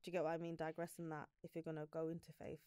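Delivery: ordinary delivery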